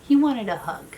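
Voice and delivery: normal talking voice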